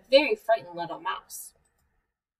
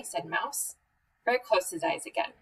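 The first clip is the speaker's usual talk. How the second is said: ordinary delivery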